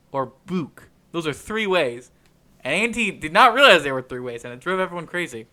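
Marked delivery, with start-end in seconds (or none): 0.5-0.9 "book" rhyming with spook; long oo. Shown as a demonstration. Listing three ways to say it, long-oo "book". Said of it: "There are three ways to say it".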